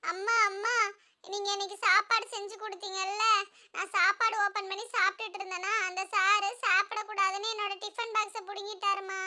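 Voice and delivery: put-on soft, whimpering child voice; mock crying. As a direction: complain tearfully to mother in a small voice. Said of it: "எதுக்கு jug அழுதுகிட்டு இருக்க?". (அழுதுகொண்டே சொல்லும் சிறுவனின் குரல்)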